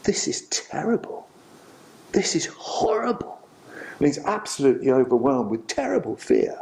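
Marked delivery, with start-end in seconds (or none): 0.0-3.4 breathy, appalled whisper with rising pitch; hushed, aghast whisper; horrified whisper. Retold or imitated. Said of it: "he just says".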